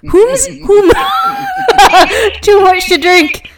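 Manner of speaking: high shrieking laugh through the words; laughing shout. Real, not acted. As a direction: lose it laughing over the grammar. Giggling fit over grammar.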